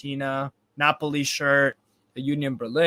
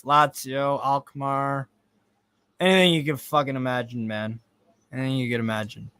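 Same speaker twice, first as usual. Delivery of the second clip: ordinary delivery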